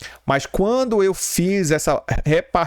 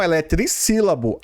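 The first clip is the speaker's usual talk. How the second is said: ordinary talk, nothing marked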